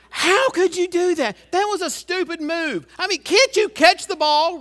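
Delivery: high, loud exasperated yell at the players; mock fan yelling at the TV. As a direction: yell at the screen like a frustrated armchair fan. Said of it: "people say the dumbest things".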